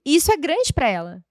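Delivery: ordinary talk, nothing marked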